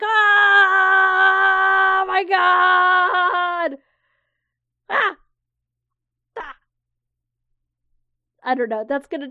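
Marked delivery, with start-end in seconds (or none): 0.0-3.8 long, drawn-out high scream; cathartic yell. Pretend. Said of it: "maybe we can yell together". 4.9-6.6 short shouted "ah" bursts; cathartic yell. Pretend. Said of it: "Can we yell together?".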